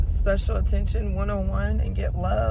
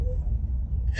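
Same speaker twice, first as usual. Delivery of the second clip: breathy sob. Real, not acted. Crying over leaving the cat.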